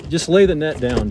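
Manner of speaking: urgent loud instruction; loud call. Real, not acted. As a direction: call out loudly. Directing net in excitement.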